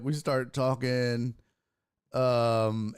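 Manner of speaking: ordinary talk, nothing marked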